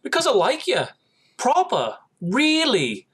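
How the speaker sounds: put-on high, light British accent; a sweet, earnest British boy saying it. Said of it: "In his little British accent".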